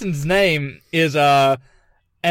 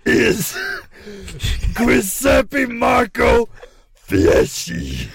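labored, halting growl of a hammy impression; 2 semitones higher, slower than usual. Naming the assassin for laughing cohosts, gruff mock voice. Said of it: "turned into just [name]".

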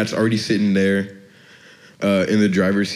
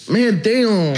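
much higher, mock-whiny coach complaint; 11 semitones higher than usual. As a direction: squeak it like an annoyed grown man. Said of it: "That was good".

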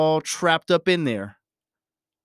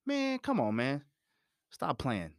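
acted exasperation; 3 semitones higher, 7 dB quieter than usual. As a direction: playfully wave off the friend. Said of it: "I'm just acting".

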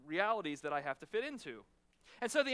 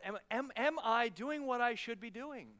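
ordinary talk, nothing marked

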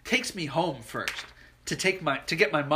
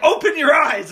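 higher, louder urgent father; urgent dad; 9 semitones higher, 8 dB louder than usual. Retold or imitated. Dad to his son, urgent command. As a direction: order it like a rattled dad. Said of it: "he's like".

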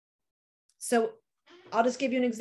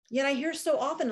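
ordinary talk

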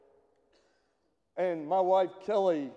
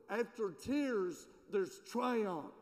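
normal talking voice

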